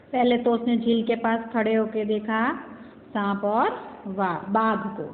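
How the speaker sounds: usual speaking voice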